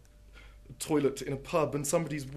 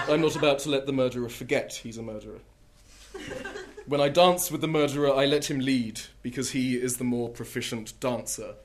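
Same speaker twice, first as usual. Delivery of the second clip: deadpan poetry reading, punchy lines; poem reading; 7 dB louder than usual. Acted. Poet reading The Murderer to an audience, deadpan. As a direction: read it like a serious poet with comic timing.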